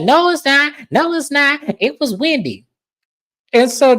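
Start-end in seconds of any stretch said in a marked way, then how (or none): 0.0-2.7 high-pitched mock argument; insistent denial. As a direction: imitate an insistent contradiction, voice pitched way up. Acting out Kiana's insistent denial.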